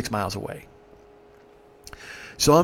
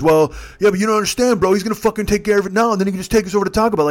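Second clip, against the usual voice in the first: put-on higher, hurried drunk arguing; pleading protest; 5 semitones higher, 4 dB louder, faster than usual. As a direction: talk the driver round, fast and raised. Drunk passenger argues with driver.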